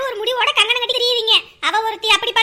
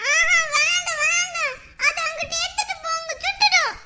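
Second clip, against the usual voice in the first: put-on child voice; 5 semitones higher than usual. Child begging to move fire.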